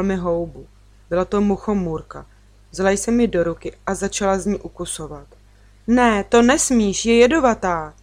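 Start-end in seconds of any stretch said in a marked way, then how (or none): 5.9-7.9 warning cry. A narrator's reading. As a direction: cry out to stop a child, stretching the words. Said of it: "vykřikla jsem". (varovný výkřik)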